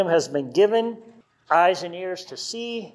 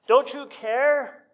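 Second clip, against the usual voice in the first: slow, higher mock plea of the disciples; panicked plea; 6 semitones higher, slower than usual. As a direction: stretch it out like a scared cry. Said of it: "the freak out of the disciples".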